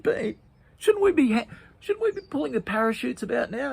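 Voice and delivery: high, loud worried plea; anxious Pete. Acted. Pete worriedly asks Bean about parachutes, high pitched.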